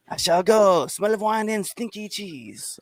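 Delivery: high, slow hammed-up French accent; a snooty Frenchman leaving, pitched high; mock French accent. Host jokes about wine and cheese, in a high mock French accent. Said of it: "You sound French as fuck".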